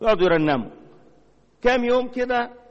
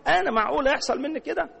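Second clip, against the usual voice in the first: high, stunned self-question; incredulous; 10 semitones higher than usual. Retold or imitated. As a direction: voice jumps high in disbelief. Believer shocked at himself.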